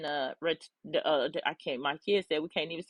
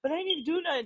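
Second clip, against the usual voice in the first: put-on high, protesting kid voice; imitating her kids, pitched up and indignant; whiny kid; 8 semitones higher, faster than usual. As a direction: play the innocent child who doesn't get it. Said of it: "They're like".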